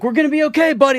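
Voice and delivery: high-pitched frantic comforting, acted out; retold panic, pitch way up; panicked reassurance. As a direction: reassure a kid while mock-panicking. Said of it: "I'm trying to talk to him".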